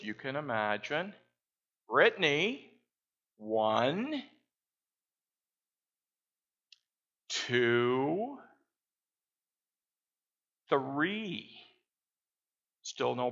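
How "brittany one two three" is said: stern mom counting. Retold or imitated. Mom counts at her daughter.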